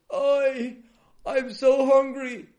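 high, loud wail of hunger; crying out. A narrator's reading. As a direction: wail it slowly, drawn out and high. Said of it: "This Joseph was crying".

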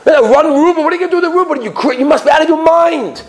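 high, incredulous ridicule; acted scornful outburst; mocking laughter. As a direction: jeer at him as if he's nuts. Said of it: "everybody's laughing at him".

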